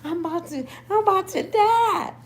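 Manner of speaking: high-pitched dramatic wail; mock distress. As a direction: wail as if the itch is killing you. Acting out frantic itching misery.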